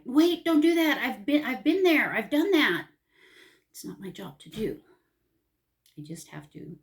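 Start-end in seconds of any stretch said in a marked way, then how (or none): 0.0-2.9 high, loud urgent warning; warning parent. Retold or imitated. Mother imitating herself warning her grown kids, loud and high. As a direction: call out to stop them, like a worried parent. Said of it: "judging it and being like".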